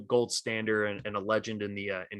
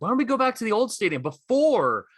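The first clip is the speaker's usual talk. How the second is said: much higher, heated comeback voice; 7 semitones higher than usual. Retold or imitated. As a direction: fling the challenge back at him, pitched way up. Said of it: "He's like".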